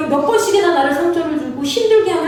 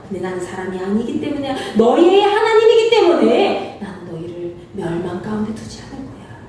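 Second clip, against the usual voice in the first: tender reassurance; 6 semitones lower than usual. Acted. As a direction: reassure gently as God the father. Preacher voices God softly.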